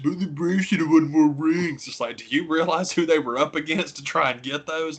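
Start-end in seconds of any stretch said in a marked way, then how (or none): 0.0-1.9 whiny fan. Retold or imitated. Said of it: "don't constantly bitch".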